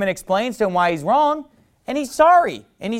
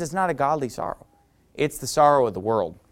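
ordinary delivery

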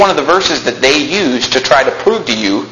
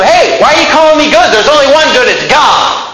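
Jesus challenging; 10 semitones higher, 4 dB louder than usual. Retold or imitated.

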